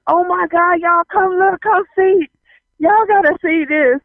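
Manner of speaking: high urgent call to come and look; shocked, excited calling. Retold or imitated. Newcomer calls siblings to see a couple, shocked and excited. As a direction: summon everyone to an astonishing sight. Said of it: "so shocked that they were just".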